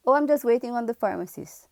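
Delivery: mock the customer who waves her off; dismissive customer.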